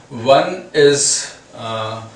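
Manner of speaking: normal talking voice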